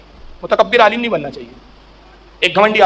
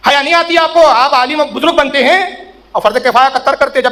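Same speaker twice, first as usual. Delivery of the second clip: scolding tone; 4 semitones higher than usual. Retold or imitated. Cleric scolding the saint for not returning salaam, high and indignant. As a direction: scold him like an outraged cleric. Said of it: "اس لہجے میں کہہ رہے ہیں جناب".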